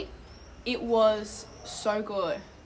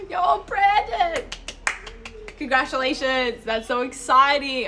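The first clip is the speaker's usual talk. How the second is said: much higher, loud, slow, near-crying cheer; second take, bigger and more squealed; squealing congratulations; 4 semitones higher, 6 dB louder, slower than usual. Real, not acted. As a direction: gush over the friend's news.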